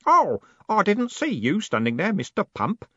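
put-on high, bright-hearted young voice; cheerful boy. A narrator's reading. Stanley noticing the golem behind him, cheerful. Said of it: "said Stanley cheerfully".

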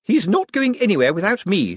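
high-pitched determined insistence; firm, protective objection; grim. A narrator's reading. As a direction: refuse grimly to be left behind. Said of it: "said Miss Drapes grimly".

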